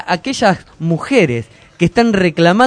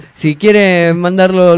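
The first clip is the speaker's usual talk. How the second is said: usual speaking voice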